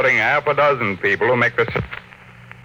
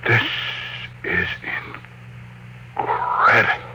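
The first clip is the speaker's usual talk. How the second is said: awed disbelief; 5 dB quieter than usual. Acted. Tycoon staring at floating metal, stunned and hushed. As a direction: barely get it out, stunned.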